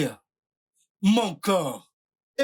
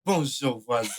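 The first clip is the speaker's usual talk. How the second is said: lower, falling answer to a greeting; neighbour's reply; 6 semitones lower than usual. Shown as a demonstration. Showing how the neighbour replies, lower demo reply. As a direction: answer back in a dropped pitch. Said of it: "et on répond".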